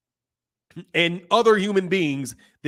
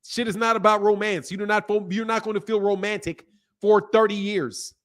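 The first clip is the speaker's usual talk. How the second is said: normal talking voice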